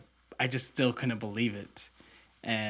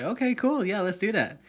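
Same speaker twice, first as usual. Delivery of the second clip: high, bright-eyed "okay, cool"; eager yes; 10 semitones higher, 5 dB louder than usual. Retold or imitated. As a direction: jump at the offer, pitch way up. Eager reply to trip offer.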